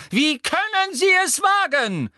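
very high, loud cry of indignation; high-pitched furious challenge; outraged shout. A narrator's reading. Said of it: "rief die Gestalt im Laufen".

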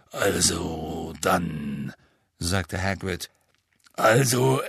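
very slow, halting confession in a put-on voice; Hagrid. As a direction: stall before admitting bad news, stretching the words. (stockende, gedehnte Hagrid-Stimme, nervös und traurig)

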